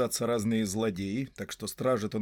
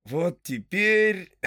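stretching; 7 semitones higher, 5 dB louder, much slower than usual. A narrator's reading. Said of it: "потянулся Пиппин".

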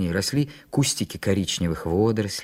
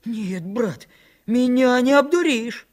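stung yelling; 12 semitones higher, slower than usual. A narrator's reading. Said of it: "завопил, как ужаленный Митька".

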